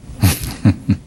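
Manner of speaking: a quick airy chuckle at the punchline; soft breathy laugh. Real, not acted. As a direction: laugh under the breath, amused.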